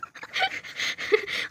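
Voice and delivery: breathless high-pitched giggle; shy giggle. Real, not acted. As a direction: giggle away the embarrassment. Shy giggle after gushing. (शरमाती हुई हँसी)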